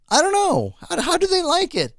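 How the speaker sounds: high-pitched incredulous complaint; exasperated bafflement. Real, not acted. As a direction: ask it in real, high-pitched disbelief. Baffled that others like it.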